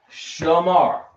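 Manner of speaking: Shamar said slowly, as /ʃəmɑɹ/ with stress on mar; teaching the word. Shown as a demonstration. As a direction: spell out Shamar for the class to repeat. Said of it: "teaching the word".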